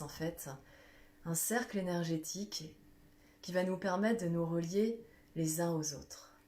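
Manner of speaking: calm guided visualization, unhurried; guided meditation. Acted. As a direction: lead the group gently through breathing and imagining. Leads a guided breathing visualization.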